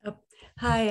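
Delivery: normal talking voice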